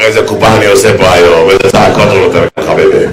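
low-pitched, sinking voice; low confidential gloating; pleased schemer. Acted.

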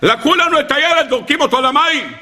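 high-pitched mock wail of parents; acted cry of protest, high; imitated anguished outcry. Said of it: "וצעקו צעקות נוראות".

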